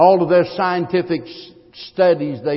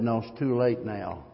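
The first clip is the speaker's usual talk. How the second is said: low, soft quoted verdict; hushed voicing of a final no; quiet refusal; 5 semitones lower, 10 dB quieter than usual. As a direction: lower and softer, imitating God's answer.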